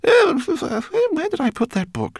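high stammering rat voice; flustered muttering. Acted. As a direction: fumble for words, high-pitched. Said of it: "she muttered".

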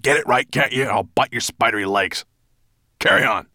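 loud, high-pitched dragon scolding; angry threat. A narrator's reading. Dragon threatening his storyteller, loud and cross. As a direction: threaten the servant, then order him on.

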